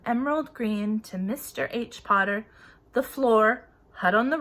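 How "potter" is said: ordinary delivery, nothing marked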